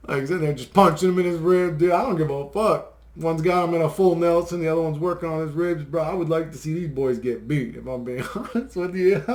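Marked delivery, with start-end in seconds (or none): none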